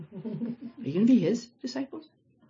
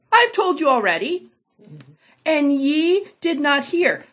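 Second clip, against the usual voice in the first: sarcastic retort; 8 semitones higher, 6 dB louder than usual. Acted. Healed man snapping back at the Pharisees, sarcastic. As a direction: let the impatience show on every word.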